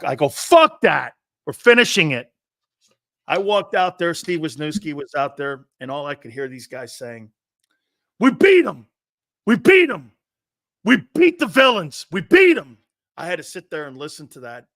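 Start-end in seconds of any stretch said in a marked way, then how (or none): 0.3-2.3 loud, raised-pitch refusal; defiant retort. Retold or imitated. Player to coach who wants to pull him, loud defiant outburst. Defying coach in retold game. 8.2-12.8 high, loud gloating cheers; jubilant victory yell. Retold or imitated. Imitating opponents celebrating the title win, loud exultant shouting.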